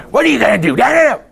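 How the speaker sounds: high-pitched mock rant at airline staff; hammed-up screechy complaint without full volume; mock screaming. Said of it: "screaming at ticket people".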